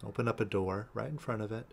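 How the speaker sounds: soft, unhurried meditation guide with long pauses; guided meditation. Real, not acted. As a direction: guide them gently inward, leaving space between phrases. Leads a releasing meditation.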